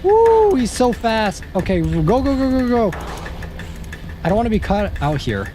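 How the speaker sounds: squealing high panic; shrieking while on the run; being chased. Real, not acted. Being chased by fast enemy.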